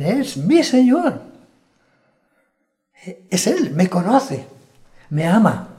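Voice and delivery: high, loud cry of recognition, acted out; joyful recognition. Sheep hearing the Lord's voice, overjoyed recognition. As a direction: exclaim it high and loud, stretching the first words. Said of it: "cuando oyen la voz del Señor dicen".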